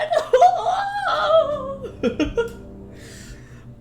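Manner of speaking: high, drawn-out squeal of laughter; laughing. Real, not acted. Amused at own grim story, laughing high. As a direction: burst into a long high laugh.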